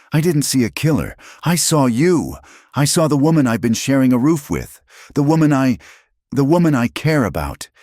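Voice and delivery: high, heated, urgent insistence; frustration boiling over. A narrator's reading. Man stung by her injustice, boiling over in frustration. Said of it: "his own frustration boiled over".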